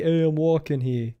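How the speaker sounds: light mock New York street accent; hammed-up New York accent. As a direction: quote the movie line in a New York accent. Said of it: "We don't talk like that".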